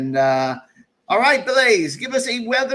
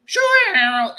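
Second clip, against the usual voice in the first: a high, loud, squeaky go at T-Boss; T-Boss impression; 13 semitones higher, 6 dB louder than usual. Host guessing how T-Boss talks, high and loud. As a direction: fumble for the boss's voice, pitched way up. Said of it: "I forget how T-Boss talks now".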